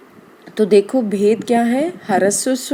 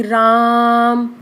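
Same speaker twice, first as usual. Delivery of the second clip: long drawn-out call of राम; calling someone over from far away; calling from afar; 3 dB louder, much slower than usual. Shown as a demonstration. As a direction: call राम across a distance, vowel stretched. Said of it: "किसी को तेज़ आवाज़ में बुलाना है".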